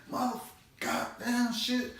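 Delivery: ordinary delivery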